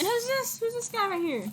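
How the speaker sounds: high-pitched, nosy uncle voice; high, eager questioning; curious uncle. Acted. Uncle asks about the nephew's friend.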